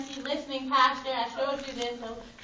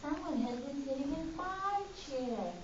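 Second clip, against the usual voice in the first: softer Mama Bear voice; a gentler, medium bear voice; middle-sized voice; 6 dB quieter than usual. Acted. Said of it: "in her middle-sized voice".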